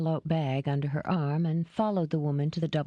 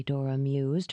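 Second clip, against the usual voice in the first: plain reading voice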